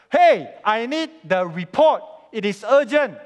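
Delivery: urgent demanding boss. Retold or imitated. Boss coming into the office for a report, urgent and demanding. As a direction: demand it, pressing each word out loudly.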